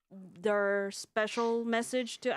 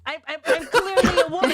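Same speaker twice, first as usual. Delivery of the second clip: laughing; 8 semitones higher, 12 dB louder, faster than usual. Retold or imitated. Reading out a fan comment.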